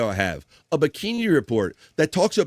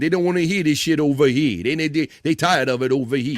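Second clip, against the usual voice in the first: mock New York accent. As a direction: complain like a fed-up New Yorker. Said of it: "don't trust the New York accent".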